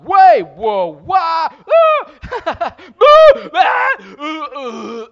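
loud, high mock whoops and wails; emotional roller-coaster noises. Shown as a demonstration. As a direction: yelp through a string of wild emotions. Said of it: "the soundtrack of my day".

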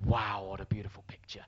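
half-whispered mock reverence; hushed awe. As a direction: marvel under your breath. Acts out his awed reaction.

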